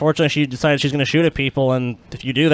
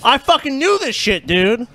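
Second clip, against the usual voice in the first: vindicated outburst; 8 semitones higher, slower than usual. Real, not acted. Vindicated about the YouTuber's return.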